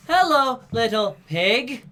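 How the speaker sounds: high, loud, drawn-out monotone; hammed-up toneless line reading, stuck on one high note; mocking wooden voice acting, loud and high; mock Julia Roberts. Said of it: "she has no voice in that movie".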